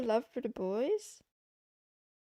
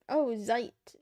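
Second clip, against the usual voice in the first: usual speaking voice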